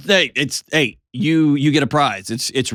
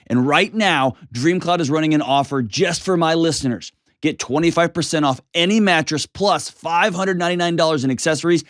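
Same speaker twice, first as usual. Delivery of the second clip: upbeat sponsor read, pitched up; 6 semitones higher than usual. Acted. Host reading a mattress ad to listeners, upbeat ad voice. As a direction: sell the mattress like a radio spot.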